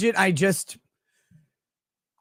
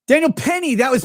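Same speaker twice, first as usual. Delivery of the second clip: sudden recall; 6 semitones higher, 4 dB louder than usual. Real, not acted. Suddenly remembers the name.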